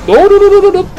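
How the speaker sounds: high, loud frantic string of no; frantic real alarm, rapid-fire; panicked no. Real, not acted. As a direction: yelp it in panic. Panic as enemy attacks.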